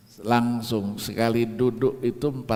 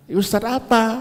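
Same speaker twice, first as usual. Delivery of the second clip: concerned question; 10 semitones higher, 4 dB louder than usual. Retold or imitated. Ustadz answering crying man.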